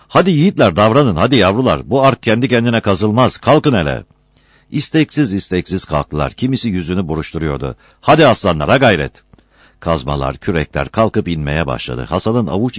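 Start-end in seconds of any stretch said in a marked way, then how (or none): 0.1-4.0 louder, higher rallying cry; rousing call. A narrator's reading. Foreman rallies tired workers. 8.0-9.1 louder, higher rallying cry; rousing call. A narrator's reading. Foreman rallies tired workers.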